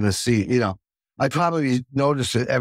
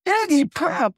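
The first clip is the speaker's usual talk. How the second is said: mock announcement; 13 semitones higher, slower than usual. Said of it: "it was like".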